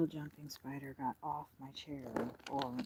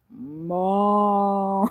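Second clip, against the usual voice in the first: loud drawn-out cow moo, higher; cow impression; 6 semitones higher, 20 dB louder than usual. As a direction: belt out a long cow moo. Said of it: "They're like".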